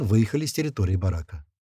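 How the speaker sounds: usual speaking voice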